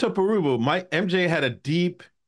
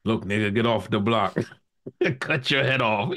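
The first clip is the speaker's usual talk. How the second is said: deep, mock-threatening voice; Brittany Griner; 7 semitones lower than usual. Host imagining a giant woman's voice, deep and menacing. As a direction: drop low for a tough deep-voiced woman. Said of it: "sound just like Brittany Griner".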